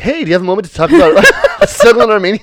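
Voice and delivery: teasing, hurried charity-solicitor voice; donation canvasser. Retold or imitated. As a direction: pitch it like a street fundraiser, then laugh. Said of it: "you were like a donation person".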